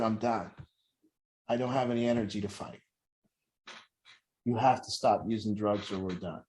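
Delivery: low, soft, drained father; retold plea, hushed and worn out; imitated tearful surrender. Father telling a child to stop using drugs, tearful and spent. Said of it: "the tears are coming down".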